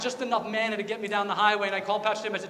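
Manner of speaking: ordinary delivery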